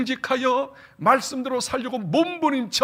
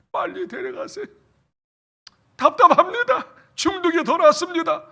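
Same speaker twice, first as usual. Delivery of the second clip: pleading, rising to a loud anguished cry; despairing complaint; 8 semitones higher, 6 dB louder than usual. Retold or imitated. Preacher voicing despairing believers, pleading to be taken. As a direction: voice a worn-out believer begging to die.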